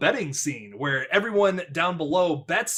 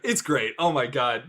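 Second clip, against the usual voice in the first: ordinary talk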